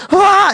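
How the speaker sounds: high piercing mock scream of terror; retold terrified scream. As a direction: shriek like the terrified woman. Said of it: "this woman comes screaming at us".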